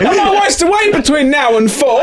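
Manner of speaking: high, hammed-up desperate pleading; mock panicked plea. Watching the food van on camera, mock panicked plea. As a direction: beg in exaggerated panic, voice far up high.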